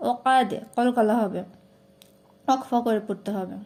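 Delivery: ordinary talk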